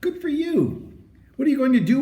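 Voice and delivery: high, bright praise; delighted cry. A narrator's reading. Doctor praising Henry's win, high delighted cry. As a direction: cheer him on, delighted. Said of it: "cried Doctor Moore".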